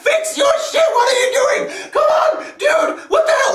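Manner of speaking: very high, strained yelling; acted-out frantic screaming, laughter creeping in; shouting and screaming. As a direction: scream at your teammate in mounting exasperation. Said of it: "I started shouting and screaming at him".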